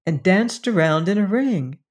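ordinary delivery, nothing marked

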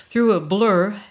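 plain reading voice

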